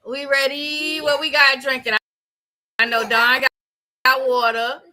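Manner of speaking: ordinary talk, nothing marked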